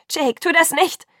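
high, loud plea; desperate loud plea; pleading cry. A narrator's reading. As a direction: cry out to stop him. Pleading as he withdraws.

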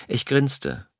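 plain reading voice

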